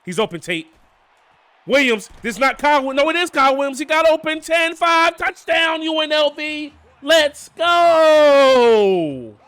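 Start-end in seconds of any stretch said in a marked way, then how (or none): 1.7-6.7 rising, louder touchdown call; excited play-by-play. Real, not acted. Gamer calls his team's touchdown, excited and loud. 7.0-9.4 long, loud drawn-out shout; celebratory shout. Real, not acted. Gamer celebrates his touchdown, loud triumphant shout.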